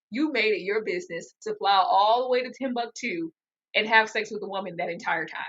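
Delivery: ordinary delivery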